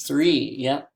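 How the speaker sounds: normal talking voice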